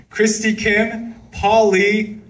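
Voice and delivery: ordinary delivery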